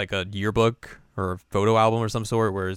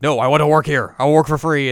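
loud, higher devoted insistence; insistent maid; 6 semitones higher, 9 dB louder than usual. Retold or imitated. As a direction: insist firmly out of loyalty.

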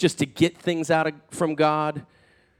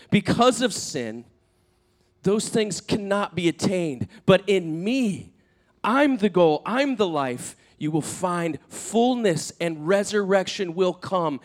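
slow, higher, weighty proclamation; emphatic promise; 5 semitones higher, slower than usual. Retold or imitated. Jesus explaining life this side of heaven, emphatic. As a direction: declare it deliberately, pitch lifted.

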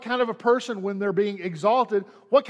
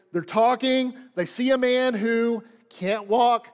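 ordinary talk, nothing marked

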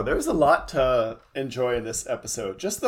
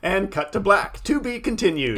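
mock cliffhanger announcer; 8 semitones higher, 3 dB louder than usual. Acts out the cliffhanger ending.

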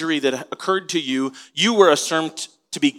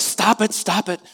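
mock crowd hush; 6 semitones higher than usual. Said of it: "The crowd says".

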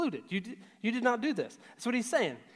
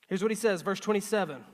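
ordinary talk, nothing marked

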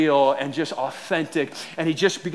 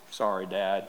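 low, soft sheepish voice; puppy-dog apology; 7 semitones lower, 9 dB quieter than usual. Retold or imitated. Nine-year-old facing his father, sheepish apology. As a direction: imitate a guilty child's small apology. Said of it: "with those puppy dog eyes".

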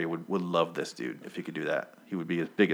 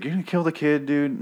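high, alarmed protest; alarmed henchman; 8 semitones higher, 3 dB louder than usual. Retold or imitated. Henchman warns Negan he'll kill the kid, high alarmed cry. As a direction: yelp it out in alarm. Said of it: "He's like".

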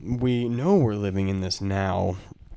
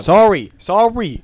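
put-on British accent; 10 semitones higher, 11 dB louder, much slower than usual. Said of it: "Why, why are you using that accent?".

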